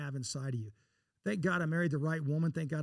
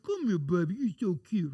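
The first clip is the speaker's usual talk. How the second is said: put-on sweet baby talk to a cute dog, a little louder; cooing to a dog; 4 dB louder than usual. Owner cooing at the fat Labrador on the porch, sweet baby talk. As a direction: coo at the dog like it's a baby.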